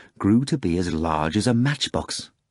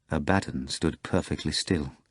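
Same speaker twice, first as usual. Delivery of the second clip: usual speaking voice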